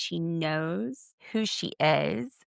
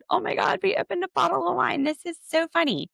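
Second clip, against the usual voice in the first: giddy imitation; 12 semitones higher than usual. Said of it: "They're just laughing so hard".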